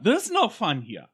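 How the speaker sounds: put-on high, strident German accent; hammed-up German-accented rant; mock German officer. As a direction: scold in a cartoon German accent. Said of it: "Freaking Gestapo".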